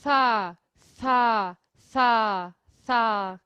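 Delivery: "sa" said with tongue between teeth; syllable sa drilled. Shown as a demonstration. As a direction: repeat "sa" slowly with lisped s. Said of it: "E aí você pode fazer". ("sa" com a língua entre os dentes)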